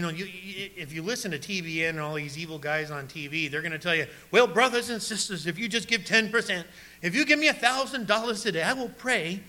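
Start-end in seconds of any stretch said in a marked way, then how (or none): 4.3-9.4 higher-pitched southern preacher pitch; mock a southern TV preacher working the crowd; southern televangelist accent. TV evangelist asks congregation for money, mock southern accent. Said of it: "they all have a southern accent".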